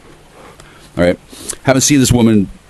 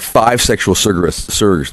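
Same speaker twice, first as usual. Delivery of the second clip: ordinary delivery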